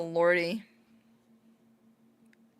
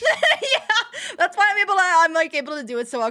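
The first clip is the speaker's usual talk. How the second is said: excited; 10 semitones higher, 6 dB louder than usual. Real, not acted. Excited explanation.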